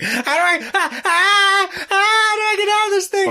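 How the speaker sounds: flail and wail in hammed-up panic; mock panic. Host joking about being stuck in a sleeping bag, mock panic. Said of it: "I'm constantly like".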